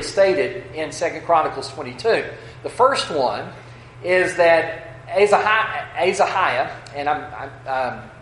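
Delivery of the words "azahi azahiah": Azahiah stumbled over, with z and h swapped from Ahaziah; mispronounced name. Real, not acted. Preacher slipping on a king's name, Azahiah mispronounced with z before h. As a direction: trip over Azahiah, breaking off and retrying it. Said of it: "excuse me, I knew I was gonna do this".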